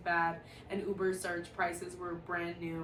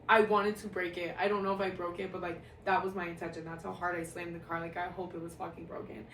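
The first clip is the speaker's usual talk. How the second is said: normal talking voice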